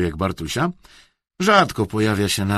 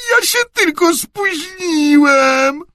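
very high, loud, drawn-out wail; whined; 8 dB louder, slower than usual. A narrator's reading. Boy excusing himself to headmaster, whining. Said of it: "wyjęczał Bartuś".

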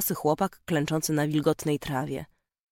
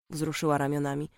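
plain reading voice

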